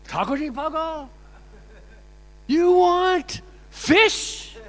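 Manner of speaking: high, loud, drawn-out incredulous question; mock outraged disbelief. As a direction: stretch it out, loud and scandalized. Acts out babaji's outraged disbelief.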